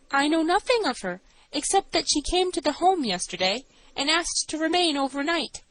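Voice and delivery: high-pitched woman witness; nervous assistant matron. A narrator's reading.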